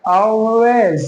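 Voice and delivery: Always drawn out as "aw-wez", L hidden; slow Always, rounded first vowel, falling at the end; dark L demo. Shown as a demonstration. Teacher models it for learners, dark L demo. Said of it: "कैसे बोलेंगे".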